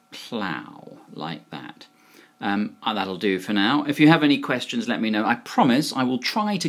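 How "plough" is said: Plough said softly and low, rhyming with cow; pronunciation demo. Shown as a demonstration. Teacher wraps up the lesson, Plough repeated softly with OW. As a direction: say Plough quietly with a falling OW. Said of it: "So that's how you spell it".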